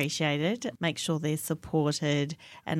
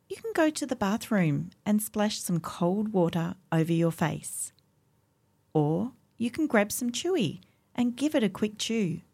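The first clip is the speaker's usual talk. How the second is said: calm guided voiceover; 4 semitones higher, 3 dB louder than usual. Acted. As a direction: guide them through it gently. Scripted calm minute segment.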